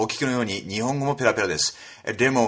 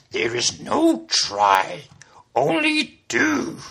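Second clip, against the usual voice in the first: high, very slow sage voice; slow, squeezed little master voice; wise master creature; much slower than usual. Shown as a demonstration. Yoda line misquoted.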